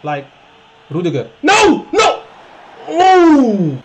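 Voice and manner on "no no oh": loud, high cry of dismay; anguished shout. Real, not acted. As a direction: yell at the miss. Chance missed.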